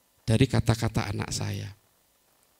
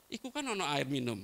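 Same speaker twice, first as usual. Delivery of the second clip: dismissive wife, voiced much softer; offhand wife; 11 dB quieter than usual. Wife brushing off her thirsty husband, quiet and offhand. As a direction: toss it off quietly, unbothered.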